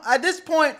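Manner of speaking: ordinary talk, nothing marked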